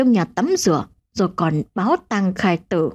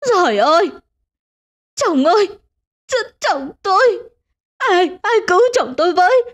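wailing scream; 10 semitones higher than usual. A narrator's reading. Wife screams as her husband dies. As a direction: scream for help over a dying husband. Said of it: "bà Nga hét lên".

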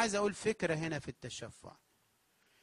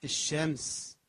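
normal talking voice

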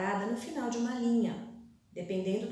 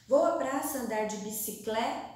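"bicicleta" cut after bicicle-, rising; broken off mid-word; 3 dB louder than usual. Shown as a demonstration. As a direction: leave "bicicleta" hanging before its last syllable. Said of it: "não deu mais na linha novamente". ("bicicleta" cortada em bicicle-, em suspenso)

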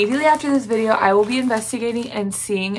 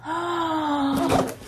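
delighted gasp; 6 semitones higher, 5 dB quieter than usual. Real, not acted. Seeing the gift.

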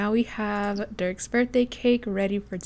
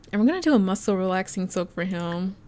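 usual speaking voice

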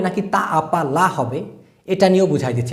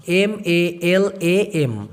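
spelling letter by letter; much slower than usual. Shown as a demonstration. Teacher spelling মালাম for students, slow. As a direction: spell it out slowly, one letter at a time. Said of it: "আর একটা শব্দ আছে মালাম".

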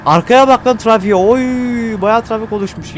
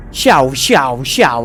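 jet noise imitation; 5 semitones lower, much slower than usual.

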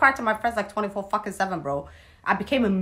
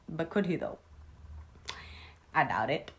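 dry whispered doubt; whispered aside; 7 dB quieter than usual. Real, not acted. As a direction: drop to a whisper, sceptical. Sarcastic aside after the quote.

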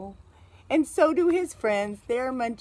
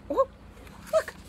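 plain reading voice

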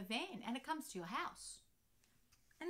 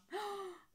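shocked gasp; 9 semitones higher than usual. Acted. Ben shocked by bath.

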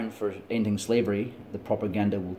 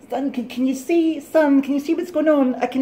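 high, loud, sing-song mum voice; imitating mum; 8 dB louder than usual. Son imitates his mum struggling to video call, high-pitched. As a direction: pitch way up like a confused mother on a phone. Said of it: "trying to get mums to use things like mobile phones".

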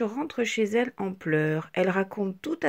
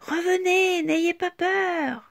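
higher, slow calling out; coaxing call; 8 semitones higher, 4 dB louder, slower than usual. A narrator's reading. Petite Tache calls the fleeing shapes back, slow. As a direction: call them back gently.

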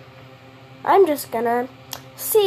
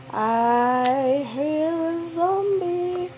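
spooky sing-song; 3 semitones higher, 4 dB quieter, much slower than usual. Acted. Spooky repeat of zombie line.

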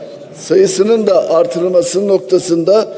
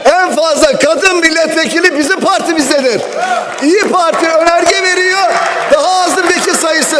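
angry shouting; 7 semitones higher than usual. Real, not acted. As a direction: shout it over the chair's interruptions. Heated protest at the podium. (öfkeyle bağırarak)

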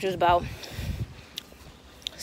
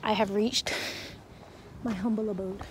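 ordinary delivery

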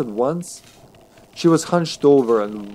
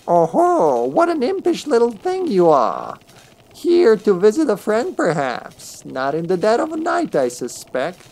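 put-on high, sly old crone; 10 semitones higher than usual. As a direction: tease the intruders like a cunning witch. Crone speaks to the thieves.

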